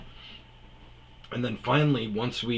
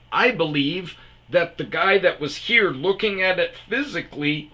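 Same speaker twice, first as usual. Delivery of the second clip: high, insistent pushback; retelling an indignant rebuttal; heated retold argument; 5 semitones higher than usual. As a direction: argue it emphatically, pitch riding high.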